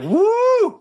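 a high, loud joyful whoop copied back; imitated whoop. Guest mimicking the host's whoop on his headphones, high and loud. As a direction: echo her excited woo into the mic. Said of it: "I was like".

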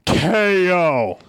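drawn-out mock impression; Kevin Owens. Host answering the name prompt, drawn-out mock impression. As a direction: stretch both syllables like a silly catchphrase. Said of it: "All right, Kevin Owens".

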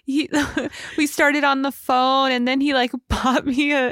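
high, hammed-up weepy retelling; mock tearful Joey. Joey fakes tears to gossip Abby, mock weepy high voice. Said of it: "like, kinda crying".

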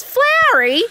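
loud, high mock indignant squawk; outraged impersonation. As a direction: throw it back in squeaky disbelief. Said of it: "that's what she sounded like".

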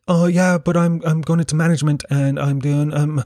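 retold defensive babble with stammered restarts; making excuses. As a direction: imitate yourself scrambling to justify yourself. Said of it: "I started making excuses".

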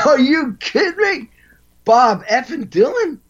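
can't believe your luck; thrilled disbelief. Retold or imitated. Drummer answering the producer's [name] offer, high thrilled disbelief.

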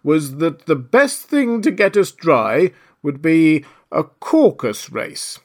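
slow, emphatic, huffy delivery; offended. A narrator's reading. Dodo proposing how to get dry, offended. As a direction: draw it out loudly, a little affronted. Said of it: "said the dodo in an offended tone".

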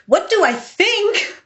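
high, slow disbelieving echo; incredulous. Retold or imitated. Retelling the agent's Buffy offer, high incredulous echo. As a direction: repeat the question in stunned disbelief.

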